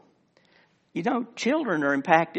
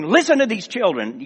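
higher-pitched mock outrage; indignant; 4 semitones higher than usual. Pharisees objecting to children praising in the temple, indignant. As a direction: act out an affronted, uptight complaint. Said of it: "they're very indignant".

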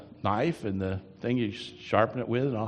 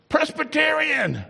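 exclaimed shout; 6 dB louder than usual. Acted. Exclaims name in sermon.

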